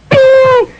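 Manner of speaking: high sharp bing; bing sound effect. Retold or imitated. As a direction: ring it out like a bat hit. Bat hitting sound.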